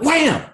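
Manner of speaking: a high, punchy shout of wham; mock punch sound effect. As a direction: slam the word out like a fist landing.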